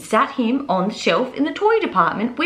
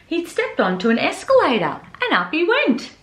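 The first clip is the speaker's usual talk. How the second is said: plain reading voice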